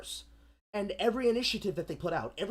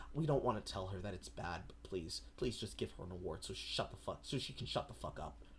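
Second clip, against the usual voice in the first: hushed aside; 12 semitones lower, 12 dB quieter than usual. Retold or imitated. Friends whispering aside.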